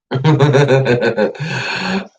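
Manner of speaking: long, high giggling laugh. Real, not acted. Amused by making weird faces.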